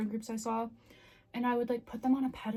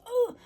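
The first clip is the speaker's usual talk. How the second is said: frustrated groan; 13 semitones higher, 6 dB louder than usual. Real, not acted. Frustrated groan about losing friends.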